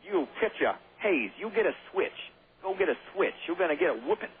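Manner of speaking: lightly voiced strict schoolmaster threatening a switch; schoolmaster impression. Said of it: "That was my impression of a, I don't know, schoolmaster".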